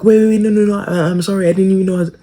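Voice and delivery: high, flustered mock apology; pleading kid. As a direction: squeak out a panicked apology to the team. Kid pleading to his team.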